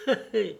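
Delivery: rire bref et aigu; un éclat de rire amusé. A narrator's reading. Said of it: "en éclatant de rire". (Lord Henry éclate de rire au vœu de Dorian)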